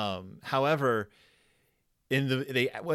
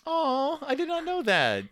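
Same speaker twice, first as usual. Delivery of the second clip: delighted surprise; 4 dB louder than usual. Real, not acted. Hearing they made movies together since fifteen, real surprise. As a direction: react to news you never knew, pitched way up. Learns a surprising fact from co-host.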